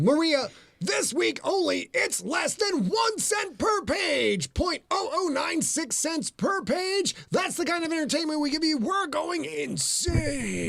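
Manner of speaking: high, slow, shouting pitchman for the price; hype pitch. Pretend. Cohost pitching the book's price to Maria, high and shouting. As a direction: oversell it like a late-night ad.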